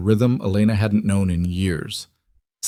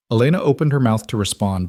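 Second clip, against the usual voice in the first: ordinary delivery, nothing marked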